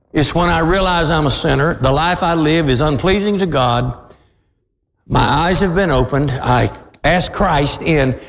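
usual speaking voice

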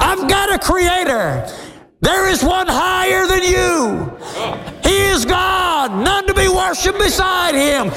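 high shouted proclamation, slowing on the last lines; nature screaming. Pretend. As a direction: cry it out as creation itself. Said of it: "it's screaming".

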